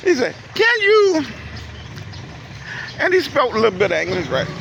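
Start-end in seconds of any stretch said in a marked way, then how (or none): 0.5-1.2 high, light mock attendant voice; imitating attendant. Attendant asking him at the car window, high mock voice. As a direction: pitch it way up like the small attendant.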